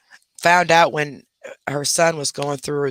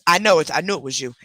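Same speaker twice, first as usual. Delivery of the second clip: ordinary delivery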